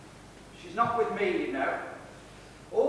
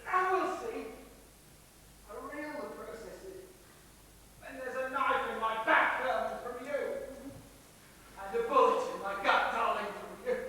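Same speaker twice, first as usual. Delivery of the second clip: high, weakened, halting delivery; dying speech; 6 semitones higher, 4 dB quieter than usual. Acted. Wounded victim addressing both attackers, dying speech. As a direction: speak in broken phrases, as if wounded.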